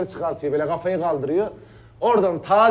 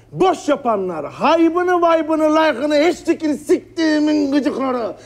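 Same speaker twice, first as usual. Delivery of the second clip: heated rant; 7 semitones higher than usual. Acted. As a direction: spit out every annoyance to the doctor. Venting a list to doctor.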